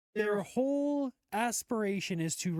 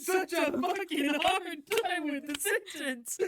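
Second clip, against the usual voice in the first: can't stop laughing; helpless laughter; 9 semitones higher than usual. Real, not acted. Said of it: "She's still wheezing".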